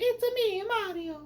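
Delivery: very high, loud cartoon Italian accent; Mario's Italian accent. Reviewer imitating what people expected of Mario, high Italian accent. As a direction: call it out as a squeaky Italian plumber. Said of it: "it's not like he's doing an Italian accent".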